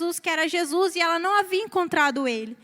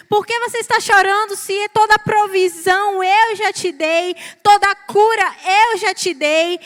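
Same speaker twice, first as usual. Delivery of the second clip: louder and louder, voicing Jesus to the church; preacher voicing Jesus, rising to a loud climax; building preaching; 5 dB louder than usual. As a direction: press each question harder like a preacher.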